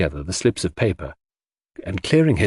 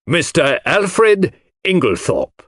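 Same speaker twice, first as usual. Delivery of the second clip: with a flourish; 9 semitones higher, 4 dB louder than usual. Acted. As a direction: announce the culprit like a showman. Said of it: "said Poirot with a flourish".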